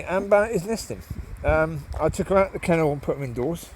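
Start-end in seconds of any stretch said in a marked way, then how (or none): none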